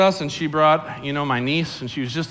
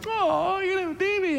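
very high cooing gush; gushing admirer; 4 dB quieter than usual. Retold or imitated. Church folk cooing at a pregnant woman, high gush. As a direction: coo over the bump like a fond aunt.

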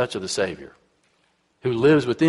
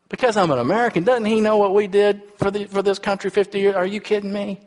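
higher, disbelieving outburst; incredulous retelling; 8 semitones higher than usual. Retold or imitated. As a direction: act stunned and indignant at the insult. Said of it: "I said".

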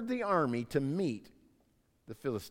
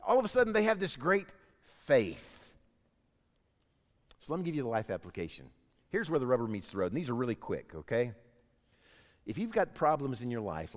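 normal talking voice

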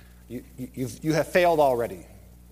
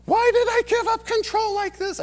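high, louder mock-panicked wail; panicked rider; 6 dB louder than usual. Rider regrets giving up control: mock panic. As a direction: cry it out like you're plunging down the loop.

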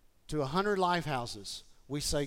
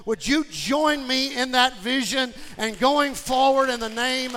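loud, raised-pitch rallying sermon; rally the congregation at full voice; impassioned preaching; 8 semitones higher, 10 dB louder than usual. Acted.

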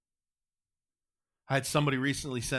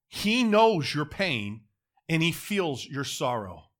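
slow emphatic; 4 dB louder, slower than usual. Real, not acted. Preacher emphasises comfort.

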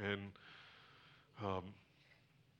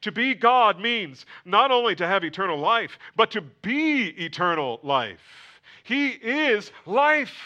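loud, high preaching climax; fervent preaching; 8 semitones higher, 13 dB louder than usual. Acted. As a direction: proclaim it to the whole church. Preaching at full force.

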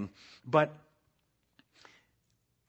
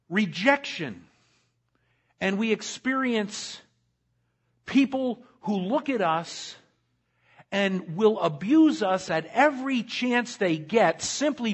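preaching at full force; 7 semitones higher, 5 dB louder than usual. Acted. Pastor preaching to his church with rising force. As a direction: preach it big to the congregation.

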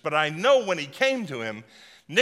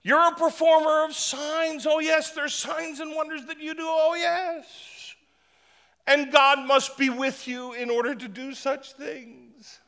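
very high, gushing flatterer voice; fawning Nicodemus; 11 semitones higher than usual. Retold or imitated. Preacher playing Nicodemus gushing flattery at Jesus, very high. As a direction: gush over him in a high sugary voice.

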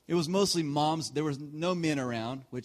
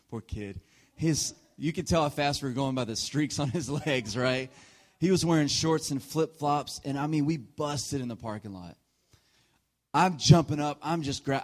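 ordinary talk

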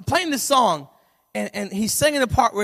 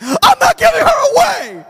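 high, loud, slow protest; distraught shout; 9 dB louder, slower than usual. Retold or imitated. Husband insisting to his wife, high distraught shout. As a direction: wail it like a heartbroken father.

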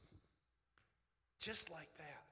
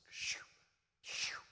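whooshing sound effect; 7 dB louder than usual. Retold or imitated.

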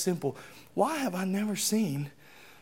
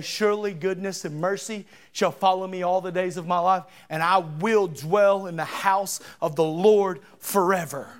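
bold proclamation; 3 semitones higher, 5 dB louder than usual. Shown as a demonstration. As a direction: proclaim it to the whole church. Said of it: "You gotta stand up and say".

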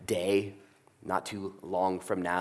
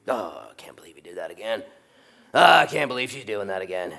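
mock frustrated mutter; 4 dB louder than usual. Voicing God frustrated.

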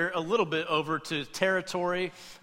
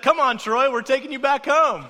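much higher and louder, bright coaxing call; mock cheery mom; 9 semitones higher, 10 dB louder than usual. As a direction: call to the fish like a sunny parent. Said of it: "She said".

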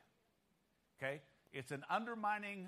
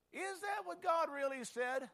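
high, incredulous doubting question; 9 semitones higher, 4 dB louder than usual. Retold or imitated. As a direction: imitate a tempter sowing doubt, pitched way up. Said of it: "He will question".

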